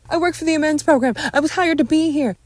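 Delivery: high, loud, urgent explaining; loud plea under threat; panicked protest. Acted. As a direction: shout it out defensively.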